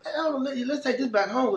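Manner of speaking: high, stunned disbelief; can't believe what he's seeing; incredulous. Retold or imitated. Retelling how he stared at rival gangs getting along, high and incredulous.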